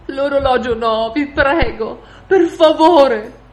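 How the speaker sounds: high, loud, drawn-out begging; whining plea. A narrator's reading. Old man begging to keep his watch, whining. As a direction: wail the plea, stretching the last words. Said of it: "continuò il suo piagnisteo".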